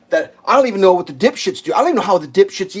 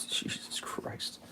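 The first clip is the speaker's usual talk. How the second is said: whispered exasperated oath; 19 dB quieter, much slower than usual. Real, not acted. Muttering to himself at the griddle, whispered. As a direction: breathe it out under your breath.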